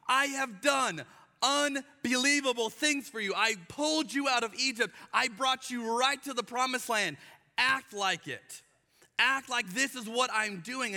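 high, slowed, pleading voice of God; God reminding his people, fed up; God listing what he did for the Israelites, exasperated. Retold or imitated. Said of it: "And God's going".

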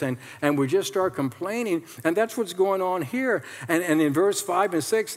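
usual speaking voice

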